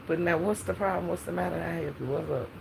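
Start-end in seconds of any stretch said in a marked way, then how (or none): none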